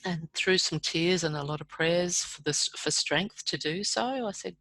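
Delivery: ordinary talk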